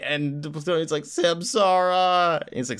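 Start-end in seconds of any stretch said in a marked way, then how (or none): 1.0-2.4 drawn-out name. Retold or imitated. Plutonian naming his sidekick.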